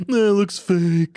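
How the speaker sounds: hammed-up jaded critic, dragging the words; dismissive scoff. Podcaster mocking his own fussiness about effects, exaggerated dismissive scoff.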